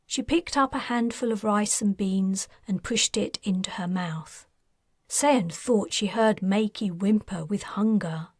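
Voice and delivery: ordinary delivery, nothing marked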